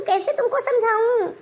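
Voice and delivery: ordinary talk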